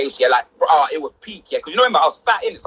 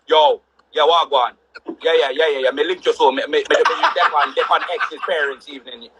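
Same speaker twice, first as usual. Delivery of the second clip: lower, Jamaican patois phone chat; yardy dad on phone; 4 semitones lower than usual. Retold or imitated. Yardy dad on the phone at parents' evening, Jamaican accent. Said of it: "proper yardy dad".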